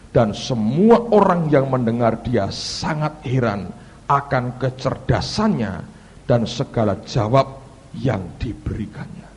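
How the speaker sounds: loud, slow, emphatic scripture reading; pembacaan ayat. Real, not acted. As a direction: proclaim the verse word by word. Reads a Bible verse emphatically. (membaca ayat dengan lantang dan lambat)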